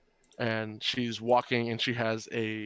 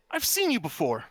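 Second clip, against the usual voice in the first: higher, louder accusing woman; high-pitched woman's voice; 7 semitones higher, 5 dB louder than usual. Acted. Woman squinting at someone she recognizes, high-pitched voice. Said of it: "in a high-pitched woman's voice".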